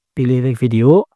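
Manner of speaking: usual speaking voice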